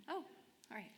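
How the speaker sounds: normal talking voice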